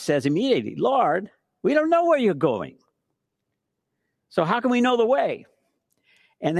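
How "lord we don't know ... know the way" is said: puzzled disciple. Retold or imitated. Thomas asks confused.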